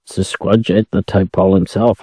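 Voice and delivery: plain reading voice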